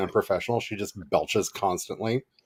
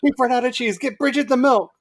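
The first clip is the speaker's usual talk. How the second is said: higher, louder party-host call; frantic host; 14 semitones higher, 6 dB louder than usual. Retold or imitated. Host at a banquet calls for Bridget, higher frantic. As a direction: shout for Bridget like a panicked host.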